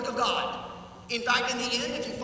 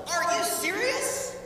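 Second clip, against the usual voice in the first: incredulous; 8 semitones higher than usual. Acted. Disciples' incredulous reaction voiced.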